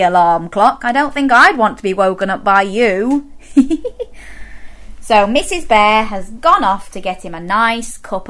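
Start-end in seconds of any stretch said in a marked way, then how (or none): 3.4-5.0 soft, breath-light giggle. Real, not acted. Presenter teasing the noisy alarm clocks, soft giggle. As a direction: titter at your own joke. Amused after teasing the children.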